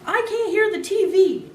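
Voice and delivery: high, slow holler; a kid hollering over the blaring TV. A narrator's reading. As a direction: yell to be heard over the TV. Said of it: "Ty hollered".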